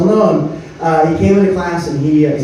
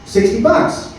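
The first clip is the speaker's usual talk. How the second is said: put-on kid dealer pitch; 13 semitones higher, 3 dB quieter than usual. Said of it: "I go".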